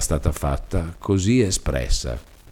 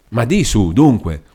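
impatient push, louder; urging; 4 semitones higher, 5 dB louder than usual. Acted. Mother urging her daughter to finally speak, impatient and louder. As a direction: come on, out with it.

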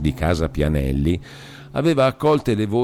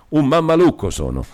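high, emphatic self-insult; insistent outburst; 8 semitones higher, 4 dB louder than usual. A narrator's reading. Demetrio insists to Beatrice he is a fool, insistent outburst. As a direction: hurl the insult at yourself. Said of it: "insisté".

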